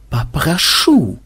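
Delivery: slow, stretched icy reproof; cold objection. A narrator's reading. Madam warning off the threatening group, cold. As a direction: draw the word out coolly. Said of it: "холодно ответила мадам".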